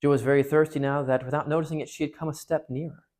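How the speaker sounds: steady storytelling voice, giving each speaker a light voice; reading a novel passage aloud to the congregation; story read aloud. Acted. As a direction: read it to the listeners like a well-loved book. Reads the Narnia passage aloud.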